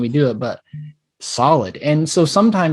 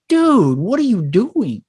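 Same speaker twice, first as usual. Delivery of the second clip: incredulous dude; 8 semitones higher, slower than usual. Retold or imitated. Retold reaction to rude parents.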